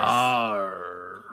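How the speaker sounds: growled pirate arr; a playful pirate grunt. Pretend. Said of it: "Why am I still talking like a pirate?".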